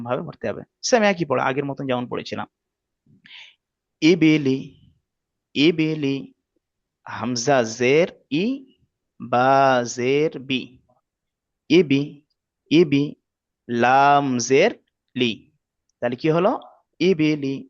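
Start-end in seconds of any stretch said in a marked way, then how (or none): 4.0-15.3 syllable by syllable, drawn out; patient teacher spelling; slow spelling drill. Shown as a demonstration. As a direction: build the word ইবিলি piece by piece. Spelling out the word ইবিলি.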